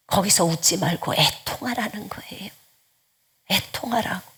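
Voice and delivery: 작게 숨소리로 속삭이는 권면; 낮게 간절히 당부해; 속삭임. Real, not acted. Exhortation whispered. (회중에게 애통하라 속삭이듯)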